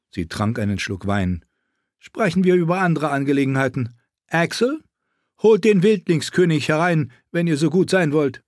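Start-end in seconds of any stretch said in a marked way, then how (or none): 2.0-8.4 queen. Acted. Queen voiced in story.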